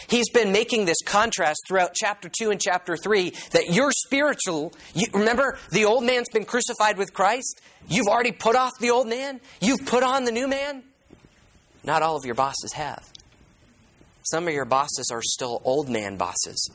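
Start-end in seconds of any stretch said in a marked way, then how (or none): 0.1-10.8 climbing higher and higher as the point lands; mounting sermon excitement, pitch climbing; rising preaching fervor. Real, not acted. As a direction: build the pitch up with each line, preacher's zeal. Preaching builds to a climax.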